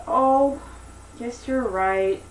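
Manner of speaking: slow, understated letdown; mild disappointment. Acted. Daisy giving in to the giant Jack, mildly disappointed. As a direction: give in with a drawn-out, resigned oh. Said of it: "she's very upset and disappointed".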